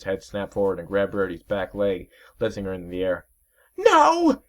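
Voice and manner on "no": very high, loud scream, slowing; panicked terrified screech; desperate cry while caught; screaming in pain and fear. Acted. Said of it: "she screamed in both pain and fear".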